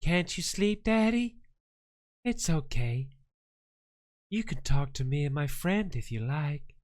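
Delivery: put-on soft, hushed creepy child talking to Daddy. Father imitates possessed son asking Daddy, hushed creepy child. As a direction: lightly voice a sweet but eerie kid. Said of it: "Going like".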